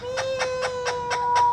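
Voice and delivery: slow, long-drawn mock meow. Owner coaxing the pet bird with a slow stretched meow.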